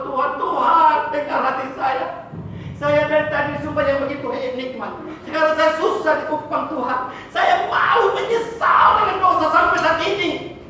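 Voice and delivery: pleading prayer rising to a shout; desperate crying prayer. Retold or imitated. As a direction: cry out to God in anguish. Retold crying prayer under tree.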